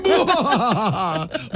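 high villain cackle; maniacal laugh. Acted. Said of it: "Maniacal laugh".

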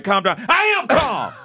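flat mock "Calm down" snapping to a high shouted "I am calm"; mock calm down then I am calm shout. Imitating someone told to calm down, high mock shout. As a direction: sneer calm down, then yell back I am calm. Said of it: "and they're like".

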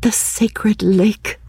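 breathy awed whisper, slowed right down; hushed exclamation. A narrator's reading. A woman to her lover at the lake, exclaiming in a whisper. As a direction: breathe it out in wonder, slow. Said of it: "She exclaimed".

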